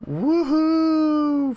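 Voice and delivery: high, loud playful whoop; mock cheer. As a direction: cheer it like a tiny party. Cheering at milestone list.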